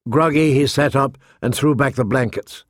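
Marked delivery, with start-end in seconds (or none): none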